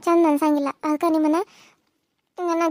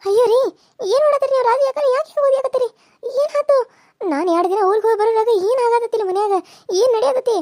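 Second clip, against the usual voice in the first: shocked alarm; 6 semitones higher than usual. Acted. Woman finding husband beating sister-in-law, shocked high cries. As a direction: burst in horrified at the beating.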